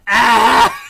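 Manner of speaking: sudden loud yelp; mock startled yelp. Friend interrupting a heartfelt moment with a loud yelp. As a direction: blurt out a sharp surprised "Ah!". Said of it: "She'll go going".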